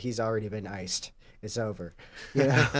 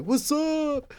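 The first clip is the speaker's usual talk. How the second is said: high goofy bro greeting; frat bro. Retold or imitated. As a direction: call out like a party bro.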